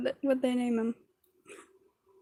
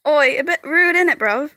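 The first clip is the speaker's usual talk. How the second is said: louder, jokey British slang voice; mock British accent; 3 semitones higher, 5 dB louder than usual. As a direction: put on a cheeky British accent. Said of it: "I'm being British right now".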